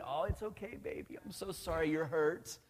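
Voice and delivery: lightly hammed-up soothing mom voice; cooing mom. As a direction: coo like a doting mother over a scraped knee. Mom voiced comforting hurt child.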